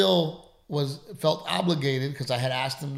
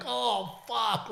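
surprised; 10 semitones higher, 3 dB quieter than usual. Retold or imitated. Retold shocked reaction.

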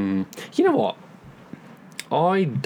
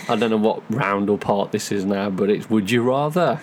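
usual speaking voice

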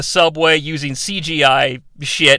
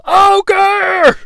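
high, loud party cheer; crowd yell; 12 semitones higher, 4 dB louder than usual. Retold or imitated. Frat crowd cheering the Ogre as he walks in, high and loud. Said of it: "He walks into the carnival".

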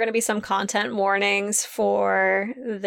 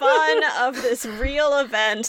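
giggling through the words; laughing; 4 semitones higher than usual. Real, not acted. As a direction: say it while laughing nervously. Laughing through content warning.

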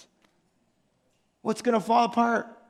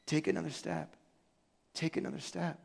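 softer, patient nudging; gentle coaxing God; 4 semitones lower, 11 dB quieter than usual. Retold or imitated. God gently urging his hesitant people onward, soft and patient. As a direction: coax them forward one step at a time. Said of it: "I think God is like".

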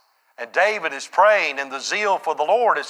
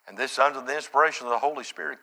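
normal talking voice

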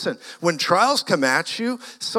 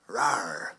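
low growl, much lower and softer; mock demon growl; 8 semitones lower, 6 dB quieter than usual. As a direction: growl like a beast.

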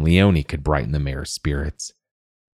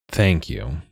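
groaned. A narrator's reading. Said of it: "the wizard groaned".

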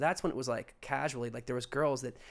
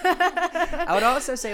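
high, slow, laughing words; 9 dB louder, slower than usual. Real, not acted. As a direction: talk through the giggles. Laughing while starting to speak.

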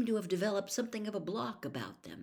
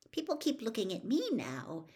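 grumpy; 5 semitones higher than usual. A narrator's reading. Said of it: "said Hermione grumpily".

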